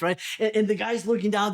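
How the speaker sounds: ordinary talk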